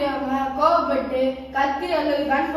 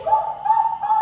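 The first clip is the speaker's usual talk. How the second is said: very high hooted "koo, koo, koo"; mocking "koo" noises. Showing a rival what his hand will become, mocking hoots. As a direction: hoot three short high-pitched mocking notes. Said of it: "இப்படி ஆயிரும்".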